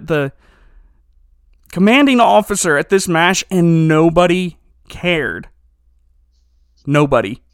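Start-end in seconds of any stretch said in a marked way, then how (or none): 3.5-7.4 slow, heavy stress on nobody; emphatic disbelief. Real, not acted. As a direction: lean into nobody, then say it again alone. Outraged nobody cared at all.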